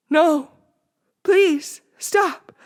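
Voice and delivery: high, loud desperate plea; boy begging his hands to stop; frightened pleading. A narrator's reading. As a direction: beg in terror.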